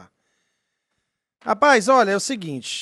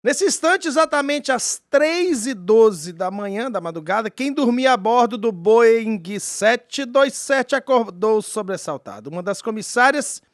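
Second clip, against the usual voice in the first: story narration; 4 semitones higher, 7 dB louder than usual. Acted. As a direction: narrate it like a mystery documentary. S0 narrates the UFO case.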